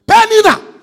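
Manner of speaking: high loud call of "Penina!". Acted. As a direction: shout the name across the room. Calling out to Penina.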